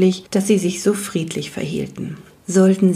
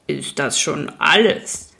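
low, slow creaking growl of a stone giant; creaky rock-biter voice; 3 semitones lower than usual. Acted. Rock biter greets the newcomer by the fire, slow creaky rumble. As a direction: grind the words out slowly like rock. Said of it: "knarrte Björn Rachzak".

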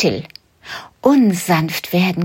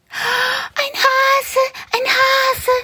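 put-on very high, excited children's cries; children shouting; much slower than usual. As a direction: kids thrilled to spot a bunny. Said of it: "rufen sie wie aus einem Munde". (sehr hohe, begeisterte Kinderrufe)

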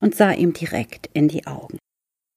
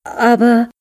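put-on protesting boy; 9 semitones higher, 6 dB louder than usual. A narrator's reading.

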